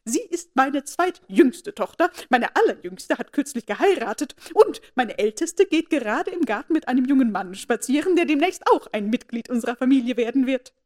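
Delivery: fawning mother showing off her daughters, delighted; delighted gushing. A narrator's reading. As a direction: gush proudly to impress the grand lady. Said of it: "entzückt über die Gelegenheit".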